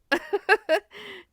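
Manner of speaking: a big high laugh bursts out; sudden amused cackle; loud burst of laughter. Real, not acted. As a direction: let a hearty laugh break loose. Laughs at own joke.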